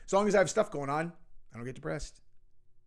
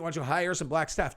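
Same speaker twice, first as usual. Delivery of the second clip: ordinary talk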